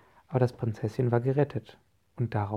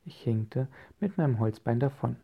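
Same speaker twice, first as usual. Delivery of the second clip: ordinary delivery, nothing marked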